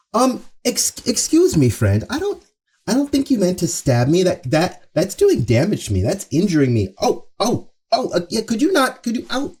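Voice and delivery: high, meek, fumbling polite voice; mock timid politeness. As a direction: play a pushover asking nicely. Mock polite plea to a stabber.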